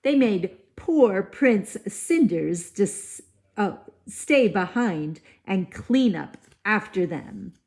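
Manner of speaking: plain reading voice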